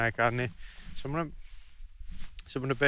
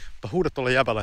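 normal talking voice

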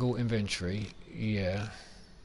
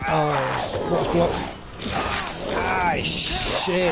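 panicked yelp; 7 semitones higher, 7 dB louder, much slower than usual. Real, not acted. Attacked by enemy, panicked cries.

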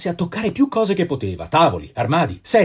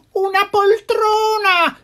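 put-on much higher, slow pleading old lady; old woman pleading; 3 dB louder, much slower than usual. A narrator's reading. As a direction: beg for a chair like a frail old woman. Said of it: "implorava una vecchiettina". (vecchietta che implora, voce molto acuta e lenta)

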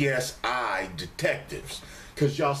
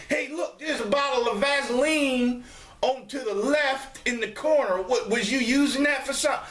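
nosy viewer; 10 semitones higher than usual. Retold or imitated. Mimicking nosy viewer comment.

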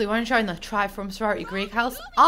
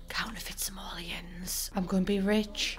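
whisper; 8 dB quieter than usual. Real, not acted. Gamer whispering about counterfeit money in secret basement. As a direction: let them in on a secret.

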